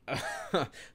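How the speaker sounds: short high-pitched laugh; a quick giggle. Real, not acted. Laugh between comments.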